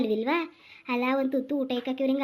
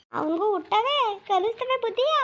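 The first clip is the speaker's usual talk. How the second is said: higher, slow bitter warning; old mother's bitter curse; 8 semitones higher, slower than usual. Acted.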